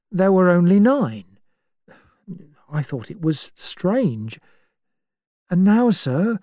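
a scared servant confiding in the doctor; frightened butler. Acted. Butler tells the doctor, shaken and pleading.

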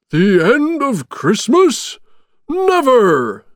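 high, loud, drawn-out indignant shout; slow, emphatic Santa bellow. A narrator's reading. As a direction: cry out in outraged disbelief. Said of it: "shouted Santa".

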